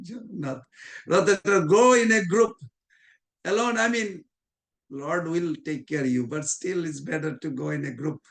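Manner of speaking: usual speaking voice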